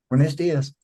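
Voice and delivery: loud, high, bright Spanish hello; imitating the woman who helped him, cheerful Spanish greeting; hotel lady's greeting. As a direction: greet like the lady in the elevator. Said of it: "do that".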